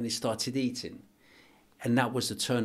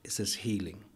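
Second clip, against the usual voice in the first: overcome with emotion. Real, not acted. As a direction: hold back real emotion while sharing something painful. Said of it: "I get too emotional".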